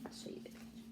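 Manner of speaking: soft unvoiced whisper; quietly aside to the class; hushed teacher aside. Real, not acted. Teacher aside while showing book.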